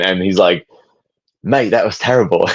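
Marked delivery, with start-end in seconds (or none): 1.4-2.5 jokey put-on British accent. Imitating chat banter with a friend, British accent. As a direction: mimic a matey British put-down. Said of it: "I do a British accent".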